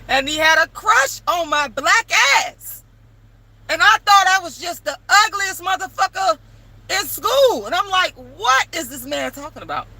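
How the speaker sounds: real amazed rant; excited disbelief. Real, not acted. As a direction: still stunned while telling it. Retelling shock.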